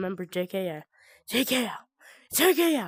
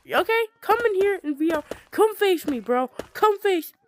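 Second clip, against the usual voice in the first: taunting; 8 semitones higher than usual. Real, not acted. Taunting a ghost in VR.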